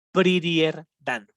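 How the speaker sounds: slow, careful model for students; slow model reading. Shown as a demonstration. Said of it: "teacher models the comparative".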